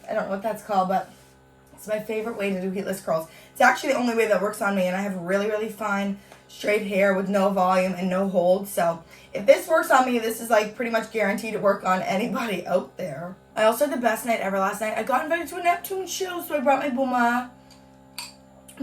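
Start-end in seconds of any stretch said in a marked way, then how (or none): none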